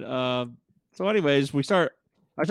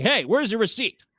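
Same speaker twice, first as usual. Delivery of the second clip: higher, demanding challenge; confronting employee; 7 semitones higher, 4 dB louder than usual. Retold or imitated. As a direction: stop someone with an accusing question. Employee confronts her in retold exchange.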